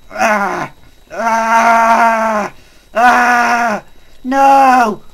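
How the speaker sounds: loud screams rising in panic; panicked screaming. Real, not acted. Being killed by spiders.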